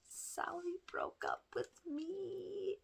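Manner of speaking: high, slow, tearful wail; breaking into tears. Retold or imitated. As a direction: sob it out as if the tears just broke through. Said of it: "broke down in tears".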